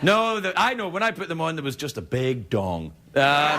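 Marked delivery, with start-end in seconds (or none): none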